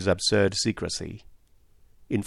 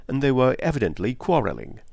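plain reading voice

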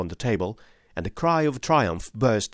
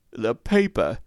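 hoarse croak from a strangled throat; 3 semitones higher, 3 dB louder, slower than usual. Acted. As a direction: croak it out weakly. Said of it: "croaked a voice behind us".

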